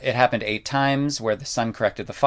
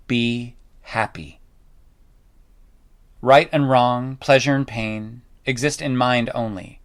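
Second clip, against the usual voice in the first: solemn scripture reading. Shown as a demonstration. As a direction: intone it like a teacher reading scripture to a class. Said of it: "But I'm gonna read a bit to you".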